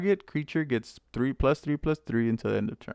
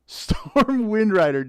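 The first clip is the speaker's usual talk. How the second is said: laughing reveal; 8 semitones higher, 6 dB louder, slower than usual. Real, not acted.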